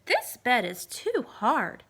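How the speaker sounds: Goldilocks. Acted. Goldilocks lying on the hard bed, slow complaint. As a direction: drag it out.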